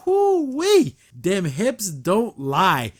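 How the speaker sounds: whoop, then savour every word; excited whoo-wee. Real, not acted. Admiring the heroine's entrance.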